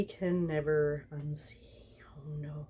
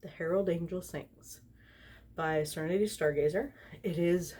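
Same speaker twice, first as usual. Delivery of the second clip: ordinary talk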